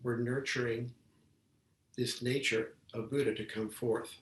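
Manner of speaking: usual speaking voice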